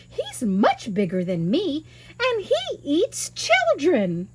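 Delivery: put-on rising high and slow on the eats children; a hint of a scared wife spooking the boy; giant's wife, warning. Wife warns of husband.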